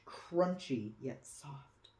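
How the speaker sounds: plain reading voice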